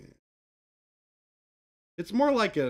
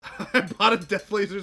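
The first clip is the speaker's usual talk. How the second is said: high, loud laughing words; 8 semitones higher, 9 dB louder than usual. Real, not acted. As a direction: laugh through the confession. Laughs while confessing purchase.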